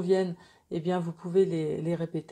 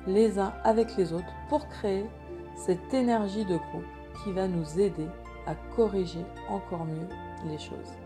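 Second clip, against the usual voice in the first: guided meditation; 5 dB quieter than usual. Acted. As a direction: guide them gently into calm.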